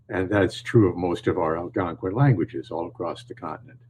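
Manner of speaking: ordinary talk, nothing marked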